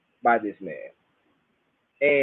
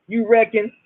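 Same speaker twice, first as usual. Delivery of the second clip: high-pitched Bahamian catchphrase; imitated Bahamian granddad, pitched up; granddaddy Jimmy; 7 semitones higher, 3 dB louder than usual. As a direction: toss it out high in a Bahamian accent. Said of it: "what my granddaddy Jimmy used to say".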